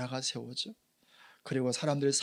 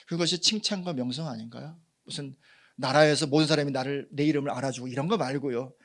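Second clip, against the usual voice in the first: usual speaking voice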